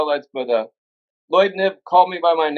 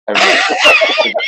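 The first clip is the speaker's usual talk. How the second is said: laughing; 5 dB louder, slower than usual. Real, not acted. Laughing remembering pot story.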